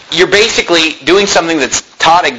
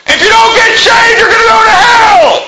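high, loud mock yell of doom; mock hellfire shout; 4 dB louder than usual. Pastor mocking street preachers: a high, loud hellfire yell.

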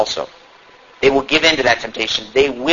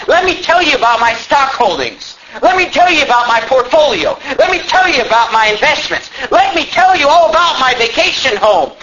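mock braggart showing off; boastful rich man; 9 semitones higher than usual. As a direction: lord your wealth over the listener. Mocking a boastful money lover.

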